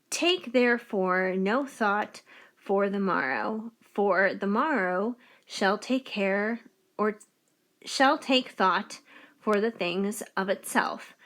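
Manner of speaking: slow, measured Bible verse reading; deliberate recitation; scripture reading. Acted. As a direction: read the verse out slowly, giving each phrase room.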